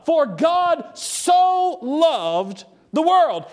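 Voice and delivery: "so" held long to intensify "loved"; slow verse, weight on "so loved"; intensified so loved. Shown as a demonstration. Preacher explains the verse, intensifying so. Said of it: "intensifies the meaning of the word".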